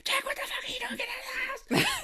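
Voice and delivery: very high, squeezed squawk down a phone; mock frantic squeal, tiny and voiceless; Brenda. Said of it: "her on the other line".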